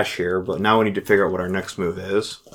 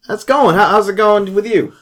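casual phone voice; 9 semitones higher, 3 dB louder than usual. Acted. Player voices his character on phone.

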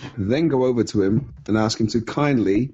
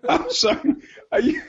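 squeaky giggling sorry; apologizing through a laughing fit; laughing apology. Real, not acted. Player cracking up at the game master, laughing.

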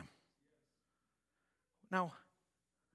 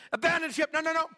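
high shouted protest; mock shouting; 13 semitones higher, 7 dB louder, faster than usual. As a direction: shout them down. Crew panicking, Paul refusing.

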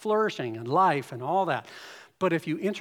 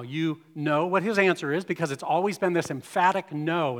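ordinary delivery

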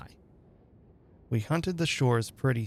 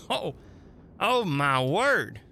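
surprised call; 4 semitones higher, slower than usual. Acted. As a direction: call out in amazement, stretching it. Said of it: "I heard him call".

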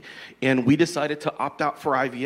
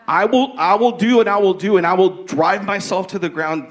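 driven; 6 semitones higher, 5 dB louder than usual. Retold or imitated. Acting out driven striving.

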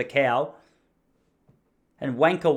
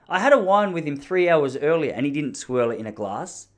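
ordinary talk, nothing marked

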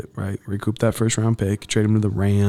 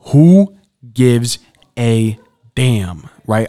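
loud exasperated rant; 2 semitones higher, 10 dB louder, much slower than usual. Real, not acted. Ranting about [name] coverage.

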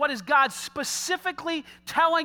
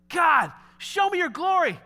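brazen demand; 6 semitones higher, 4 dB louder than usual. Retold or imitated.

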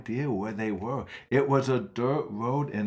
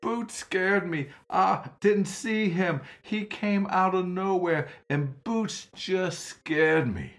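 put-on high, pleading little boy's voice; crying boy; 10 semitones higher than usual. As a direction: apologize as a frightened child. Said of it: "He started crying".

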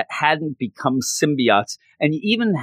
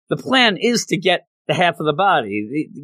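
normal talking voice

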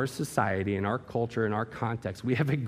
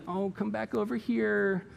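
mock gentle coaxing; 8 semitones higher than usual. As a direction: coax a stray back, mockingly tender. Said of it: "I don't think Jesus gently".

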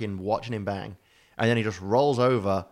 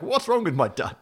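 mock Dutch accent; 8 semitones higher, 4 dB louder than usual. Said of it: "Why is my Dutch Sean Connery to you?".

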